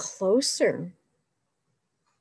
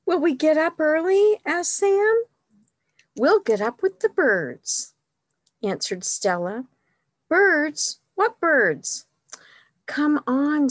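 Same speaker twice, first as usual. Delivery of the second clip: put-on lively read-aloud for children, higher and louder; story reading; 4 semitones higher, 5 dB louder than usual. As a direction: read it like a picture book to kids. Reads picture book aloud.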